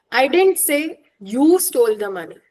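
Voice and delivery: YOU set apart and punched; "you" stressed, pointing at the person. Shown as a demonstration. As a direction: pause briefly, then hit "you". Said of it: "depending on what word I am stressing".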